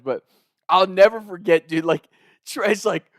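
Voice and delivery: ordinary talk, nothing marked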